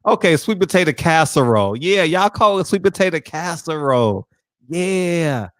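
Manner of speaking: ordinary talk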